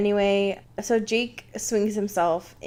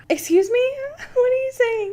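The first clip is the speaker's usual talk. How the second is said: incredulous retort; 12 semitones higher, faster than usual. Retold or imitated. Mock reply to Jacob's rude demand.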